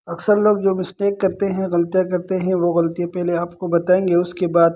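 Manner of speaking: usual speaking voice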